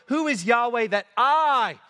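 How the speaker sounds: Yahweh brushed past, I held long and proud; stress on I. Shown as a demonstration. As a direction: build to a high, weighty I. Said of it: "is the emphasis on I".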